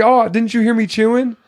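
squeaky smug parody of the caller; mocking Annie. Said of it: "she's like".

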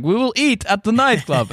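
high, eager mock Russian accent; hammed-up Russian accent. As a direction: insist cheerfully as the Russian host in a Russian accent. Russian host decides.

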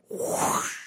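slow airy swish; imitated whoosh. Dad unwinds the rubber band, imitated soft whoosh. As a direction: blow out a soft, drawn-out whoosh. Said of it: "slower and louder".

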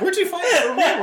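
high, quick laughing question. Real, not acted. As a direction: giggle it out fast. Repeats question, still laughing.

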